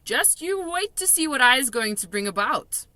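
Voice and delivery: giddy giant hurrying her along; excited giant. A narrator's reading. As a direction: BFG fizzing with excitement. BFG excited.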